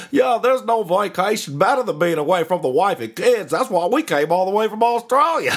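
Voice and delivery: high, hammed-up Aussie impression; Australian accent. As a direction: cheerfully boast as a mock Australian. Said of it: "They was like".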